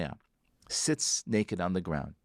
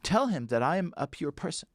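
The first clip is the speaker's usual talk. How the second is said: pitched-up desperate plea; begging the river; 6 semitones higher than usual. Retold or imitated. Elazar begs the river to talk to God, high desperate pleading. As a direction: plead with the river like your life depends on it. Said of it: "he goes to the river and he says".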